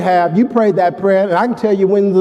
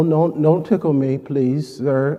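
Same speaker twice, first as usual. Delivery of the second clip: usual speaking voice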